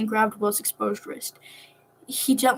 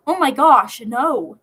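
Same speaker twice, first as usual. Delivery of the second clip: loud and higher exclamation; shocked denial; 3 semitones higher, 7 dB louder, much slower than usual. Acted. Nico loudly denying Jason's suspicion. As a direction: blurt it out, scandalized.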